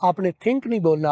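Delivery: ordinary talk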